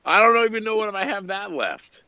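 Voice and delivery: high robotic radio voice; Bumblebee radio voice. Acted. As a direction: talk like a glitchy radio. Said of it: "You talk like Bumblebee through the radio".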